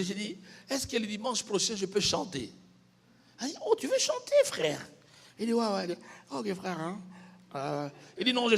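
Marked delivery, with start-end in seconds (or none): 3.6-4.8 mock surprise. Imitates the pastor's surprise.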